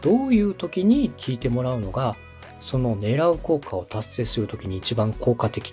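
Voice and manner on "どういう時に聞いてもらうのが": ordinary delivery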